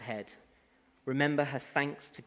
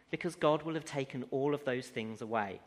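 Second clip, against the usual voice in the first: ordinary talk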